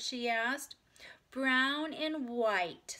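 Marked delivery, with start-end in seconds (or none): 1.3-3.0 put-on Ramona's higher, slow, loud child voice; prompt little girl. A narrator's reading. Little Ramona answers about her eye colour, prompt and drawn out. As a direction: draw it out proudly like a small child. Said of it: "said Ramona promptly".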